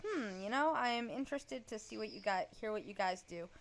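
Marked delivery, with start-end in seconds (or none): none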